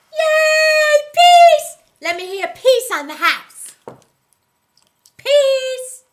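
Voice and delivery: high, very loud whooping shout; rallying cheer. Acted. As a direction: yell it to get the house cheering. Cheering for peace with audience.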